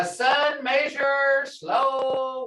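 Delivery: Sun measure slow was, word by word, very slowly; slow literal gloss. Shown as a demonstration. Teacher glossing the Muskogee word by word, very slowly. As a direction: spell out each word slowly like a literal translation. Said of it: "if you had to do this in literal".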